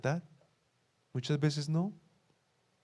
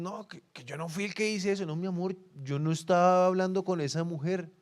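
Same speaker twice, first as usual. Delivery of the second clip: imitando una excusa a la pareja, tono más agudo; negación fingida; 6 semitones higher than usual. Retold or imitated. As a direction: finge negarlo todo con voz aguda. Imita al que niega ante su pareja. (negación fingida en tono agudo)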